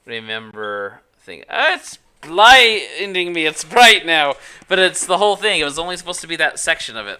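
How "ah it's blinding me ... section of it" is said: loud, high cry of dismay; loud, high-pitched exasperated protest; startled complaint. Real, not acted. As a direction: exclaim as if suddenly dazzled. Startled by glaring light on screen.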